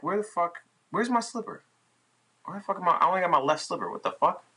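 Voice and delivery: panicking, looking for the slipper; high-pitched freak-out over a missing slipper; retold panic.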